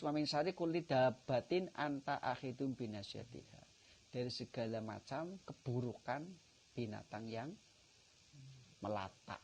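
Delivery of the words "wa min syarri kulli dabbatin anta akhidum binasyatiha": slow, louder Arabic supplication; prayer recitation. Acted. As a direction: intone the prayer slowly.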